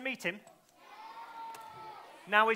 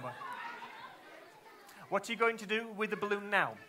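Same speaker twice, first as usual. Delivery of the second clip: ordinary delivery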